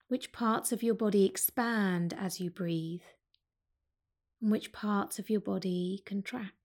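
calm yoga teacher guiding a breathing practice; guided meditation. Acted. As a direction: ease them into the breath, unhurried. Leads a seated breath meditation.

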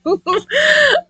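very high squealing laugh; real squealy giggle. Real, not acted. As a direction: lose it at your own joke. Laughs at dodging women joke.

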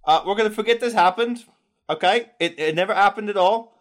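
high, fast, nervous captain voice. Acted. The wounded captain backing off from the party, high and hurried. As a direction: hurry through it like a scared captain. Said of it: "he says".

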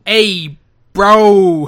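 loud, punchy mock New York accent. Cohost mimics a wrestler's fake New York entrance, loud mock accent. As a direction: shout it like a cartoon New Yorker. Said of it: "a New York accent".

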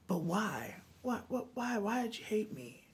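pleading why. Retold or imitated. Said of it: "And I asked him".

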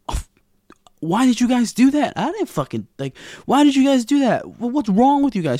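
high, loud horrified outburst; a hammed-up horrified friend. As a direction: yell it high in disbelief.

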